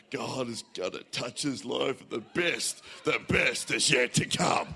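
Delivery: a deep booming preacher's blessing; another pastor's voice. Retold or imitated. Second pastor's voice affirming Andy, low and booming. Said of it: "I hear another voice in my head".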